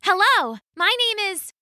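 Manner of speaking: high, hopeful greeting; a nervous hello to cheetahs; eager young woman. Acted. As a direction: reach out shyly but eager.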